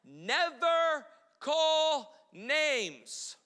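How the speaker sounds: never drawn out slow and stressed; preacher stretching out never call names; slow emphatic rule. Shown as a demonstration. Pastor to congregation, slow stressed rule. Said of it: "Number one".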